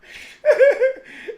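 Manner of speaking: high helpless laugh; squealing laugh; laughing burst. Real, not acted. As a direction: crack up again.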